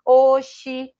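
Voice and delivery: loud exclaimed "Oxi" in a northeastern Brazilian way; mock northeastern Brazilian "Oxi"; regional slang exclamation. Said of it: "se alguém fala assim com a gente".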